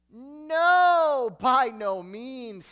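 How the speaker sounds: high, booming, drawn-out "No"; emphatic refusal. Acted. Preacher quoting Paul's answer on sinning, loud emphatic refusal. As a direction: thunder it out slowly, pitched well up.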